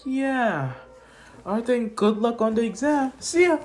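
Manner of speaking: put-on high-pitched, cheery girl's voice; light, high character farewell; voicing Uraraka. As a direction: sing out a breezy goodbye up high. Voicing Uraraka's breezy goodbye.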